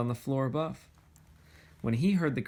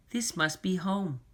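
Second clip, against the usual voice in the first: Corduroy; 5 semitones higher than usual. A narrator's reading. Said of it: "he said".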